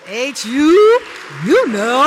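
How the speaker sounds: loud, high alumna call-out; school cheer. Real, not acted. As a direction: shout it proudly to fellow graduates, pitch soaring. Alumna calls out her school cheer.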